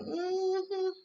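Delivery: high, sly knowing hum, then a teasing line; knowing Pam. Retold or imitated. Pam teasing Karen about Zach, high and knowing. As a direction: play the nosy coworker who sees through her.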